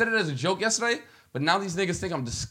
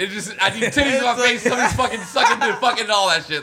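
higher, laughing friend impression; mocking impression; 4 semitones higher than usual. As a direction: laugh through a mock impression of a buddy. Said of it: "he really goes".

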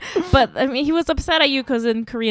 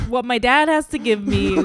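normal talking voice

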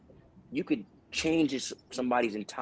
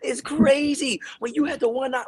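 excited neighbor; 13 semitones higher, 4 dB louder than usual. Retold or imitated. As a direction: hail a TV star across the block. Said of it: "my neighbors".

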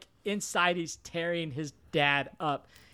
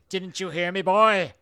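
mock cranky bookseller shout; 5 dB louder than usual. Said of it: "He's like".

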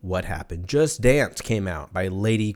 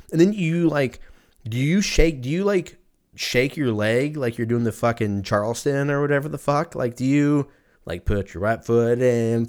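ordinary talk

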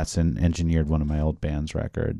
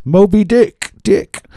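excited shout; 14 semitones higher, 12 dB louder, slower than usual. Real, not acted. Naming the famous drum solo.

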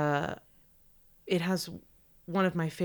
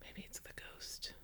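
breathy ghost-story whisper, much softer; spooky whisper; 19 dB quieter than usual. Pretend. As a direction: hush it like telling a ghost story. Spooky joke about a ghost.

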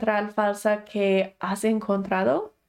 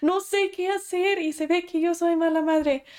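higher, pleading desperate voice; imitating a frantic, helpless mother; desperate mom; 7 semitones higher than usual. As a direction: act out a mother at her wits' end. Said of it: "desesperadamente diciendo".

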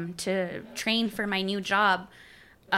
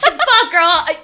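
high, loud laughing swear; laughing curse; 7 semitones higher, 9 dB louder than usual. Real, not acted. Cracking up retelling the night to friends, loud laugh. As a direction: blurt it out cracking up.

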